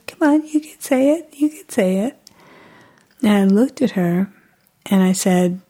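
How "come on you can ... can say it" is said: high-pitched baby-coaxing voice, imitated; acted mom urging her baby on; coaxing mother.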